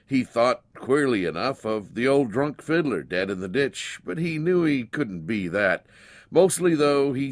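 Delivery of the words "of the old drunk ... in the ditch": usual speaking voice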